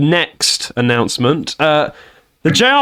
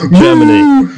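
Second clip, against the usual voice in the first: a sudden, much higher and louder cry of Germany; overexcited cheer; 13 semitones higher, 8 dB louder than usual. Real, not acted. Guest hearing his country named, cheering way too excitedly. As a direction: burst out cheering the name Germany. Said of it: "He went a bit too excited".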